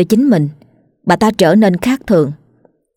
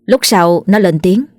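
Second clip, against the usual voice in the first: ordinary delivery, nothing marked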